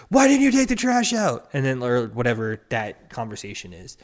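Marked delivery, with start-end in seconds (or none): 0.1-1.5 high, loud exasperated yell about the trash; nagging shout. Retold or imitated. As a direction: shout it at your partner, fed up. Partner complaining about chores.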